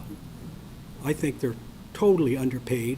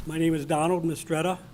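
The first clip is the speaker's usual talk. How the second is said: speaking up. Real, not acted. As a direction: speak up so everyone hears. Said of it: "If you could speak up".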